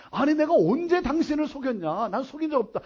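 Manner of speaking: acted protest; indignant denial. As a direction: push back against the accusation. Man's retort in acted quarrel.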